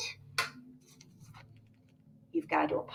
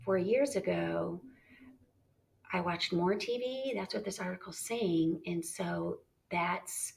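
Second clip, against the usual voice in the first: soft musing; softer inner-thought voice; thinking voice; 3 semitones lower, 9 dB quieter than usual. Shown as a demonstration. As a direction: muse quietly to yourself. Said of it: "So I'm thinking".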